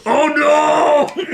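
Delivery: laughing, high "oh no"; laughing mock scream. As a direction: shout it through laughter. Laughing retelling of the girl's reaction.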